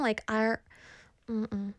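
ordinary talk

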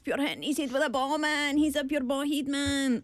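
put-on high-pitched Glaswegian scheme accent; Glasgow ned. Example of talk from a Glasgow scheme, ned accent. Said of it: "in a scheme in Glasgow".

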